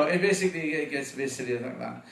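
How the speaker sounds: usual speaking voice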